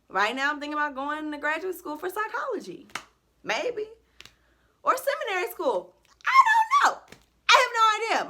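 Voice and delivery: giddy and lost about her next step; giddy uncertainty. Real, not acted. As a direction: squeal out the not knowing. Excited uncertainty about future plans.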